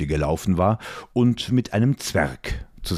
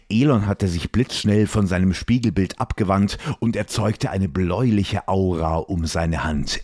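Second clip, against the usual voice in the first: ordinary delivery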